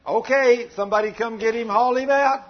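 loud, brisk mock pastor calling the next one; hammed-up loud pastor; mock pastor shout. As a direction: bark it out like a pastor moving the line along. Preacher acting pastor hauling dead man.

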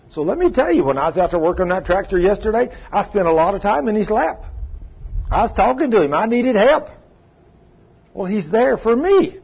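loud, high preacher's call; fired-up preaching. Acted. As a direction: preach it out to the church. Preaching at full force.

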